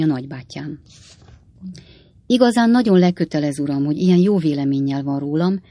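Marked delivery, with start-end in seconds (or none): none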